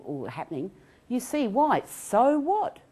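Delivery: ordinary talk, nothing marked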